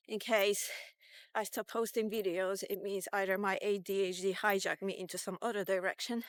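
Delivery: ordinary talk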